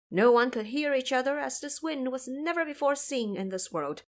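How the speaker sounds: usual speaking voice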